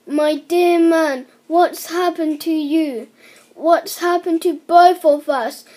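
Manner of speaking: wife crying out to her shrunken husband; alarmed cry. A narrator's reading.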